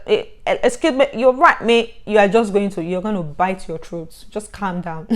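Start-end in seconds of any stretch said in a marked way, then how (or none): 0.1-1.9 higher, stumbling attempt at a British accent; hammed-up British accent with false starts; mock British accent. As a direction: imitate a newcomer straining at a British accent. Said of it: "using the accent".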